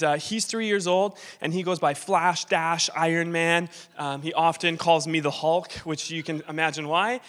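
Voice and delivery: ordinary talk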